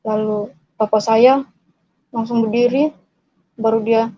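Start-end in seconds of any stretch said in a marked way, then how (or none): none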